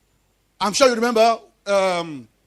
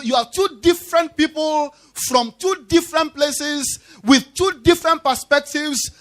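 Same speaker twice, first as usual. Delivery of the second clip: ordinary delivery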